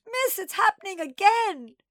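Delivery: put-on distraught child crying out; wailing. A narrator's reading. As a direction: wail it in despair, stretching the end. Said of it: "she wailed".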